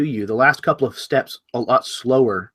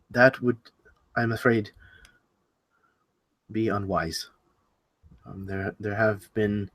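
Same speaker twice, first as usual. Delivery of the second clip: soft, halting, reluctant admission; somewhat ashamed; 3 semitones lower, 5 dB quieter than usual. Acted. Rabbit warning the party against it, somewhat ashamed. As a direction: trail off lower and quieter, as if ashamed. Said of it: "he kind of looks somewhat ashamed himself".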